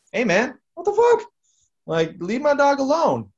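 high, loud, outraged yelling; angry yell. Retold or imitated. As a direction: snap at the noisy neighbor, high and loud. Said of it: "then I will be like".

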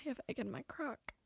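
barely audible mimicked whisper; hushed, playful echo of a quote. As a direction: whisper the line for laughs. Both repeating a quoted line, whispered.

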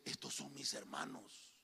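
José quietly recognizing his brothers; hushed José. Acted. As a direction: say it low and hushed like a secret. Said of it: "Dijo José".

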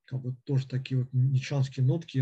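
normal talking voice

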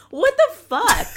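high, loud disbelief; shocked. Retold or imitated. Reacting to the stripper scene, shocked and loud. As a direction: blurt it out in shock. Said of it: "and I'm also like".

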